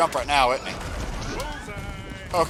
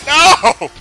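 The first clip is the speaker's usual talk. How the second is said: yell; 14 dB louder than usual. Real, not acted. Panicked yell at game.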